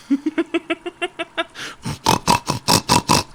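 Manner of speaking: high-pitched amused giggle. Real, not acted. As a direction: giggle high after the joke. Laughing at own mockery.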